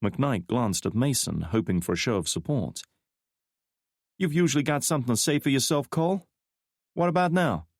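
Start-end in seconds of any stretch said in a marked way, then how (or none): 4.2-7.7 higher, fast and teasing; a cheeky young woman prodding; young woman's voice. A narrator's reading. Frost needling Mason for support, higher and fast.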